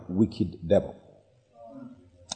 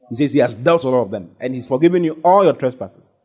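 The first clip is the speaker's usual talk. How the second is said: higher, driving sermon voice; impassioned preaching; 5 semitones higher than usual. Real, not acted. Preacher telling congregation all their trespasses are forgiven, impassioned preaching. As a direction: preach it with rising force, punching each ALL.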